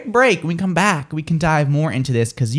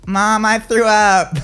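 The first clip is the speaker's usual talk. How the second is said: slow, higher mock wail to Mom; calling for Mom; 5 semitones higher, 4 dB louder, much slower than usual. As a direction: act out a drawn-out call to mother. Acting out texter calling mother.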